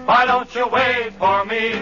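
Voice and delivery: normal talking voice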